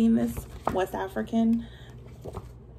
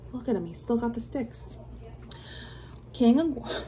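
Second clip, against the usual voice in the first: plain reading voice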